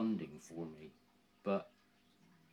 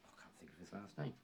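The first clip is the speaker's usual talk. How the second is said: whispered aside; 14 dB quieter, faster than usual. Real, not acted. Muttered aside while recalling.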